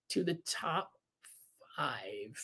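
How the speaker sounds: soft, drawn-out countdown suspense; low, hushed build-up to the big list; hushed reveal. Pretend. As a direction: drop to a slow, near-whispered announcement. Hushed reveal of the top five.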